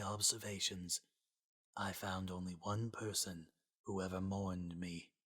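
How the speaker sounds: very soft, forcing each word out; strained. Acted. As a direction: barely get the words out. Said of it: "Sans had to fight to let out every word".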